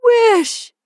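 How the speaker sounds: very high, loud command; piercing, unyielding cry; repeated strong cry. A narrator's reading. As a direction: shout the order insistently. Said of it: "repeated his wife".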